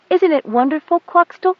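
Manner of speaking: put-on high, bright girl's voice full of awe; a young girl marvelling at the airship; little girl's wonder. A narrator's reading. Goat Dung asks her cat in high wonder. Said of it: "she asked the cat".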